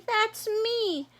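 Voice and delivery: high, thrilled little frog; Froggy, delighted. Acted. Froggy reading the ad, high delighted voice. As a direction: burst with joy.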